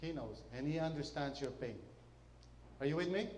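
ordinary delivery

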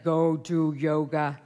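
insistent inner voice. Retold or imitated. Inner voice insisting on yoga, slow and firm. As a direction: lay out each word like a final order. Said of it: "But it's like".

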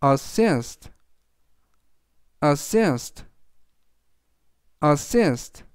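"assist" stressed on SIST, a-SSIST; teacher models "assist" for learners; model pronunciation. Shown as a demonstration. Teacher says "assist" for students, clear and steady. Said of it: "라고 발음하시면 되겠습니다".

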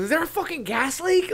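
high-pitched mock bewildered question; imitated alarm. Character at dinner with high kids, imitated alarm. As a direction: pitch leaping up in pretend alarm.

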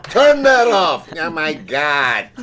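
hammed-up exasperated yell, high and drawn out; mock irritation. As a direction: yell in pretend annoyance at an alarm voice. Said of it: "totally irritated every time it comes on".

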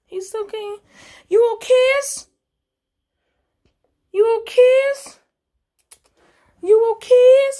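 very high cutesy questioning; high-pitched cooing check-in. Real, not acted. Baby talk to cat.

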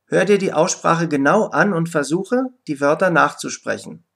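ordinary talk

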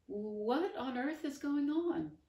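high, loud, slow bewilderment; Wilfred astonished by the talking dog. Acted. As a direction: ask it in disbelief. Astonished boy.

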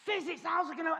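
ordinary talk, nothing marked

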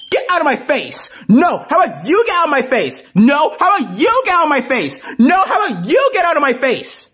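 high, insistent comeback leaning on "you"; squabbling retort. Acted. Cuphead and Daffy quarrelling face to face, squabbling retort. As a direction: throw it straight back, high and heated.

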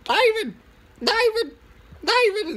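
imitating mom yelling a name from afar; mom calling out. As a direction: call across the springs like a mother. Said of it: "and then I hear".

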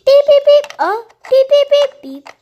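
loud, high mimicked phone ring; phone beeping. Kid imitating her phone ringing in the taxi, loud high beeps.

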